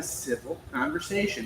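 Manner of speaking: "civil" given deliberate emphasis; measured phrase with weight on "civil"; stress on civil. Shown as a demonstration. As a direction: lean on the word "civil". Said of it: "I always emphasize civil".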